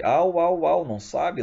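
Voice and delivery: latido imitado. Acted.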